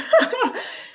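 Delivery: high burst of laughter. Real, not acted. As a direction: laugh at your own slip. Laughs at her own mistake.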